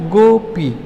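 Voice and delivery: "Gopi" in two clearly separate syllables; split in two. Shown as a demonstration. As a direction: break "Gopi" into two even beats. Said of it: "दो टुकड़ा में ये हो गया".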